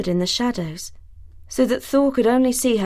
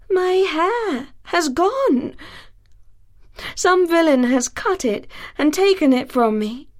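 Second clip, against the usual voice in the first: lamenting; 6 semitones higher than usual. Acted. Sif mourns her lost hair to Thor.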